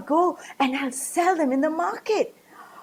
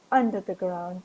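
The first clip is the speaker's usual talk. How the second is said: ordinary delivery, nothing marked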